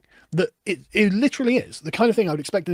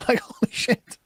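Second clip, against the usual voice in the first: laughing disbelief; 4 semitones higher than usual. Real, not acted.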